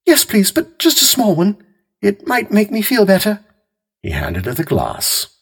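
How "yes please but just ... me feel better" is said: much higher, gentle housewife voice; Mary Maloney. A narrator's reading.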